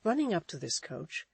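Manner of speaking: plain reading voice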